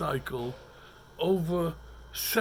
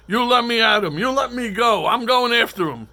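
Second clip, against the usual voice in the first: retold yell; 6 semitones higher, 11 dB louder than usual. Retold shouting to be released.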